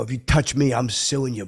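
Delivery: loud threat to the waiter; threatening. Retold or imitated. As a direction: bark it at him like you mean it.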